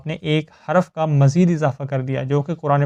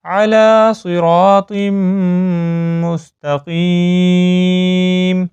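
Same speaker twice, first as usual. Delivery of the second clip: slow chanted recitation, raised and louder; Quran recitation; 3 semitones higher, 5 dB louder, much slower than usual. Shown as a demonstration. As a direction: recite with full tajweed. (بلند آواز میں تجوید کے ساتھ تلاوت)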